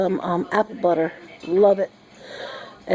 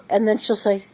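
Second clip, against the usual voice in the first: ordinary delivery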